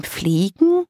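slow, baffled echo of "Fliegen", rising at the end; "Fliegen" drawn out and lifted in wonder; astonished. A narrator's reading. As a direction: repeat "Fliegen" as a disbelieving question. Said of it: "Greta starrte Daka erstaunt an".

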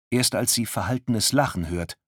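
ordinary delivery

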